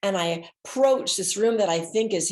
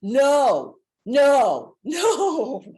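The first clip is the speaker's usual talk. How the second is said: loud, laughing no, no, no; laughing denial; 8 semitones higher, 9 dB louder, much slower than usual. Retold or imitated. Storyteller imitating hotel man laughing off the question. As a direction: laugh it off loudly.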